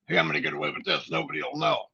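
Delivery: low, fast sly voice; mock schemer. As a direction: scoff at the scheming cheat. Mocking a scheming employee.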